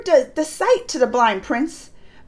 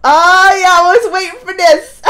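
gleeful Krishna; 6 semitones higher, 10 dB louder than usual. Retold or imitated. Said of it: "He's like".

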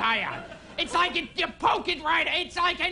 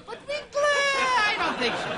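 high doubtful voice; 10 semitones higher than usual. Retold or imitated. People of the 1400s quoted.

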